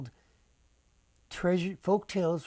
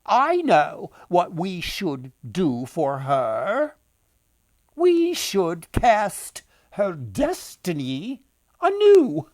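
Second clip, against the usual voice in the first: louder, slow, otherworldly fate-weaver; fateful old woman; 3 semitones higher, 9 dB louder, slower than usual. Acted. Woman tells the others they will recast the girl's fate, loud and slow. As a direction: pronounce a destiny like a fairy crone. Second woman proposes casting destiny.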